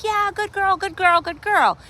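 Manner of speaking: high, bright burst of praise for the dog; excited praise. Real, not acted. Trainer praising her dog as it moves away, excited high praise. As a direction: cheer her on, pitch way up.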